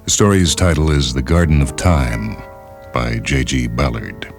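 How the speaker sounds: ordinary delivery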